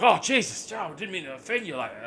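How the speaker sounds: high, loud mock alarm; startled apology. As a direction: imitate a taken-aback New Yorker apologising. Retold shocked New Yorkers apologising.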